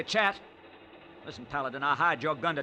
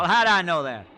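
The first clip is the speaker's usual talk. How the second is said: loud, defensive shout; a cornered man yelling his excuse; indignant outburst; 5 semitones higher, 11 dB louder than usual. Acted. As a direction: snap back in protest. Prisoner protests angrily.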